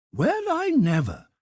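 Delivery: high, loud delighted exclamation; surprised cry. Acted. Head cook learns Carl is from Prague, loud glad cry. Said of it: "cried the head cook".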